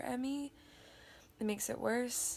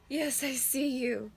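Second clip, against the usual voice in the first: louder, lifted coo to a pet; cooing pet talk; 4 semitones higher, 6 dB louder than usual. Real, not acted. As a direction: gush affectionately at your dog. Cooing at the pet mid-vlog.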